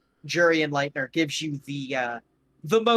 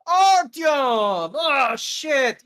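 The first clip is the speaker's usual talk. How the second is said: high, loud delighted yell; excited shout; 10 semitones higher, 7 dB louder, much slower than usual. Acted. Gogol greeting his old friend Artyom with a loud, excited shout. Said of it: "he goes".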